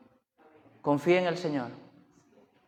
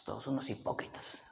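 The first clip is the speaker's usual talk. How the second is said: very low, half-whispered grumble; muttered retold disdain; 5 semitones lower, 13 dB quieter than usual. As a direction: mutter it under your breath, imitating disdain. Acts wife's dismissive verdict.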